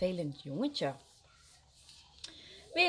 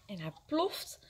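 ordinary delivery, nothing marked

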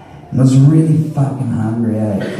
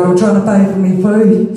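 mock protest; 7 semitones higher, 4 dB louder than usual. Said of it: "And I'm like".

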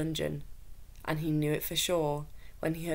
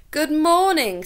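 cheerful greeting; 11 semitones higher, 9 dB louder, slower than usual. A narrator's reading. Dovetail greets soldiers.

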